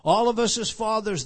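loud rallying call; loud appeal. Real, not acted. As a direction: call out to every father.

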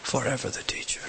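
hushed echo on "forever the teacher"; whisper. Real, not acted. Climax repeated twice.

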